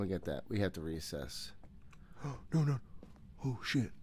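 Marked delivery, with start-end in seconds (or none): 1.6-2.5 sharp scared gasp. Real, not acted. Ghost flashing lights.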